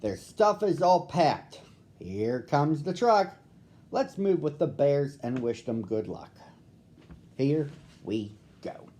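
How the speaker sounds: playful rhyming storyteller; sing-song rhyming intro. A narrator's reading. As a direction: bounce the rhyme like a children's show opener. Rhyming intro read to children.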